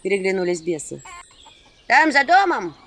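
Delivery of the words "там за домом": loud, slowed imp voices; little demons pointing the way. A narrator's reading. Imps answering.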